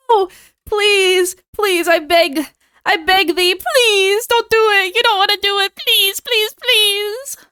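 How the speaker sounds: high, hammed-up pleading; mock begging. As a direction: whine it like a melodramatic beggar. Begging chat not to raise price.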